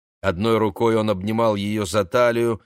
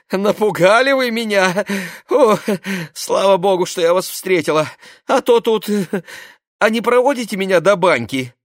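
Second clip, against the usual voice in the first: nervous, excited; 9 semitones higher, 5 dB louder than usual. Acted. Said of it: "нервно рассмеялась Роза".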